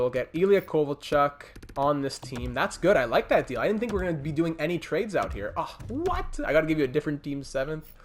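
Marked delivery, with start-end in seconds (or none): 5.6-6.4 surprised. Real, not acted. Trade demands a different pick.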